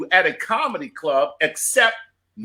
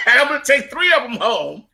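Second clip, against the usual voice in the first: higher, through laughter; laughing punchline; 8 semitones higher than usual. Real, not acted. Comic joking to his co-host about pizzas, laughing. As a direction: laugh while delivering the joke.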